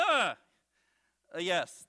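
normal talking voice

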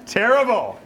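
loud, high exclamation; mock outrage. Real, not acted. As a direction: cry it out at your own bad joke. Mock outcry at own joke.

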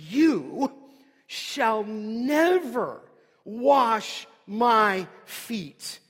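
slow, loud, stress on never; emphatic refusal. Retold or imitated. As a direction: drag out "shall never" slowly and loudly, hitting "never". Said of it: "a double negative".

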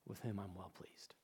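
reverent whisper of God's approval. Acted. God the Father speaking over his Son, whispered. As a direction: breathe it out barely voiced.

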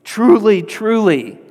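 high, loud, slow proclamation; emphatic declaration. Retold or imitated. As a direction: preach it as Jesus laying it all out. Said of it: "putting all of his cards out there".

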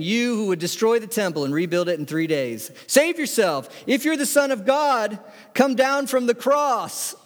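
high, mounting taunt of a heckler; derisive jeering. Acted. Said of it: "passed by derided him".

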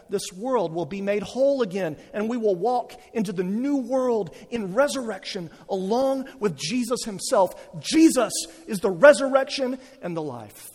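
sermon climax carried on to a strong close; emphatic preaching. Acted. Preaching at full force.

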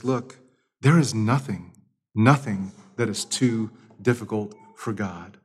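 ordinary delivery

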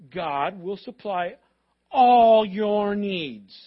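slow, loud stretch on all your needs; emphatic promise. Real, not acted. Preacher hammering the promise to the church, slow and loud.

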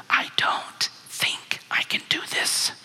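slow imitated nervous whisper. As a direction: whisper it slowly, word by word, as the reluctant wife. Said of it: "And I whisper back".

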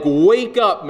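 high, urgent wake-up call; panicked. Acted. Disciples rousing Jesus from sleep, panicked. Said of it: "they're in a panic".

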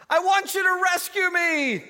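high, loud begging cry; the dangling man begs God to rescue him, shouting; desperate plea. Acted. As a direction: plead at the top of your voice.

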